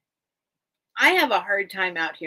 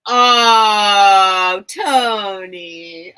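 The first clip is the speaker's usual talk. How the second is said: a big excited call of the name, much louder; delighted shout; 11 dB louder, much slower than usual. Real, not acted. Seller spotting a friend in chat, excited loud shout.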